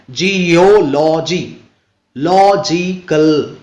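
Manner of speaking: Geology and logical slowly stretched by syllable; slow syllable-by-syllable demo of geology, logical; third-from-last stress. Shown as a demonstration. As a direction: draw out जि-यो-लॉ-जी, then लॉ-जि-कल. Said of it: "last से third syllable पे stress करना है".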